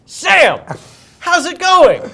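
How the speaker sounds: high, loud delighted hail; belated recognition. Retold or imitated. Star plays along after a too-long pause, hammed-up greeting. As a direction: burst into a staged old-friend greeting. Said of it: "pauses for just a little too long".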